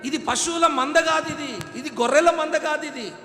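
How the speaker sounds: loud, high pulpit shouting; full-force sermon shouting; fiery preaching. Acted. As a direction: thunder it at the congregation.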